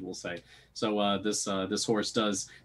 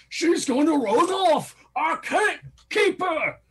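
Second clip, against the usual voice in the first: high, loud, drawn-out character shout; panicked driver; 7 dB louder, slower than usual. Acted. Driver losing hold of the spooked horse, high panicked shout. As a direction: holler for help, stretching the words up high.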